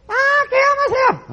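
high shrieking wail, slow and drawn out; hammed-up hysterical woman wailing; mimicking her hysterical screaming. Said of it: "She was running up and down the hall screaming".

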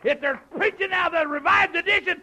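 high, shouted pulpit declaration; full-force preaching. Acted. Preacher standing by the King James Version, full-force preaching. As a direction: drive each refusal home, building to the last.

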